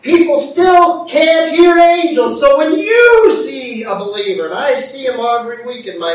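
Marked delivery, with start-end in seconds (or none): 0.0-4.4 high, loud, forceful proclamation; emphatic preaching. Real, not acted. Preacher urging folks to step in, loud emphatic delivery. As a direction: belt it out to the congregation. Emphatic repeat of quiet statement.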